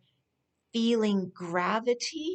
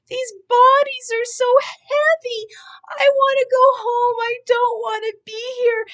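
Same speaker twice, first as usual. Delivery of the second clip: high-pitched, loud wailing sob through the words; crying; 14 semitones higher, 8 dB louder than usual. Retold or imitated. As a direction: relive the sobbing breakdown, voice cracking high. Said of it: "and I was crying, crying".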